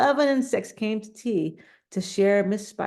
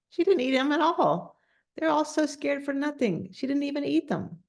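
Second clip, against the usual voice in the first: higher, insistent reassurance; emphatic; 8 semitones higher than usual. Real, not acted.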